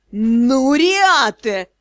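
drawn-out, rising angry groan; hissed reproach. A narrator's reading. Magister stricken with rheumatism scolds student, hissing. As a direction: stretch the name through rising anger. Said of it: "прошипел он".

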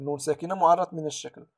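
ordinary delivery

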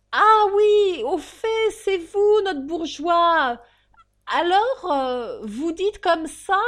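loud, high, slow cabman voice; slow-witted coachman. Acted. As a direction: drag it out like a dozy cabby. Coachman voice continues.

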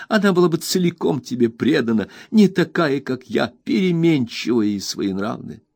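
put-on high, tearful girl's voice; Emily weeping to Ham; tearful self-reproach. A narrator's reading. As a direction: pour it out through tears.

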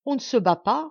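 angry, scornful answer; nervous rage. Acted. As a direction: snap it back with a rage held in. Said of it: "ricanement nerveux qui ressemblait fort à un rugissement de rage".

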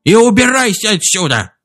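high, squeaky angry dismissal; fierce. A narrator's reading. Chief orders Duncan back to his ship, fiercely. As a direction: drive him off in a furious piping voice. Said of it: "свирепо произнёс громовержец".

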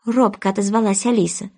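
ordinary delivery, nothing marked

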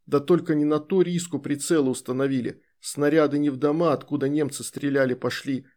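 usual speaking voice

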